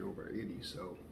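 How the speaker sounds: normal talking voice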